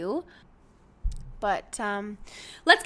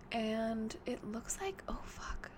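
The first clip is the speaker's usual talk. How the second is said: whispering; 15 dB quieter, slower than usual. Real, not acted. Spots stranger with flashlight outside.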